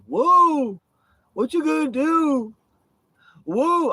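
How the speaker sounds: high, loud squeaky taunting voice; mocking falsetto taunt. Mocking the rival he threw off the RV, squeaky high taunt. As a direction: mimic a whiny tough guy squealing.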